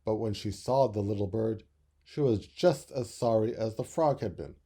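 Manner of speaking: ordinary delivery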